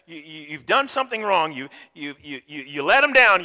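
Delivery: usual speaking voice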